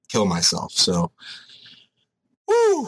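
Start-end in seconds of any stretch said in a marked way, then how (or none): 2.5-2.9 hype cheer. Real, not acted. Hyping himself up for legs.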